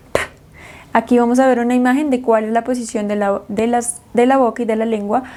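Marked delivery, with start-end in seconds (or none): 0.1-0.2 a short puff of air for P; voiceless P. Shown as a demonstration. Teacher demonstrating how P only pushes out air, voiceless. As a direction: expel the air without voicing. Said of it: "solo expulsa el aire".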